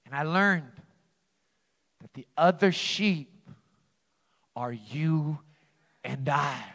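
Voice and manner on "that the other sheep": ordinary talk, nothing marked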